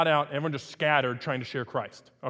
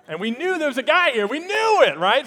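higher, louder eager telling; pitched-up eager emphasis; excited; 9 semitones higher, 8 dB louder than usual. Real, not acted. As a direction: lift it with excitement. Excited recall of certainty.

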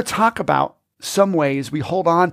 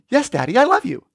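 put-on higher, bright little-girl reply; 8 semitones higher, faster than usual. Four-year-old tells her dad she loves him, in a higher little-girl voice. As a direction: sweetly answer Daddy as a small child.

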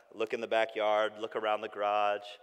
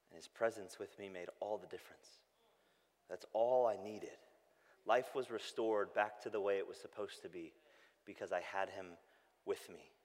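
tender relief; 4 semitones lower, 6 dB quieter than usual. Real, not acted. Tender reunion.

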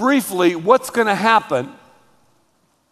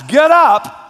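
loud raised call get up; shouted command; 6 semitones higher, 5 dB louder than usual. Retold or imitated. Preacher voicing Jesus shouting to the dead. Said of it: "when Jesus shouts".

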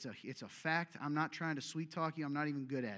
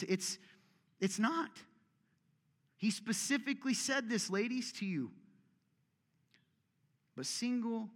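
usual speaking voice

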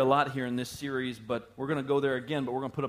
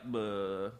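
stunned grunt; 8 semitones lower, 5 dB quieter than usual. Retold or imitated. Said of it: "And you're like".

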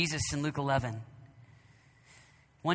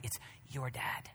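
slow, soft whisper; hushed, slow tender words; intimate whisper; 8 dB quieter, slower than usual. Real, not acted. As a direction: whisper it close.

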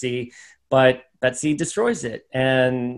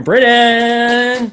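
higher, louder and slow; triumphant; 9 semitones higher, 8 dB louder than usual. Real, not acted. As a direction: crown her with the words. Triumphant end of the recap.

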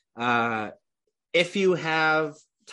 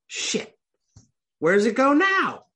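surprised; 10 semitones higher, 5 dB louder than usual. Real, not acted. Sudden realization, outburst.

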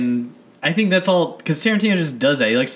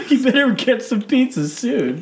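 high silly voice; 12 semitones higher than usual. Retold or imitated. Laughing mimic.

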